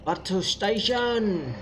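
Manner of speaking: loud slow alarm call. Acted. Calling out game alert.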